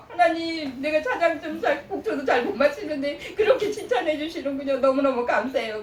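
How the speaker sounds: high, giggling thanks to God; grateful laughing thanks. Retold or imitated. Singer answering God's praise, laughing gratefully. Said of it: "찬양을 더 큰 목소리를".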